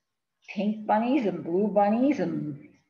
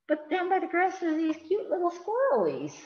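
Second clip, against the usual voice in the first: cute sweet voice; 11 semitones higher than usual. Acted. Showing cute squirrels to children.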